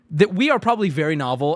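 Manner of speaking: normal talking voice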